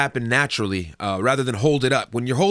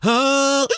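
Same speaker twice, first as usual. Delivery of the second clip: high "ah" cut short like choking; abrupt choked cutoff; 11 semitones higher, 3 dB louder than usual. Shown as a demonstration. Singer shows a bad abrupt ending that sounds like choking. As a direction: chop the note off abruptly. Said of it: "cut it off abruptly and go".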